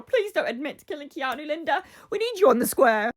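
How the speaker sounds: high-pitched mock begging; playful pleading. Fan begging Linda not to confess, mock pleading. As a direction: beg a little theatrically, voice lifted. Pleading directly to a soap character.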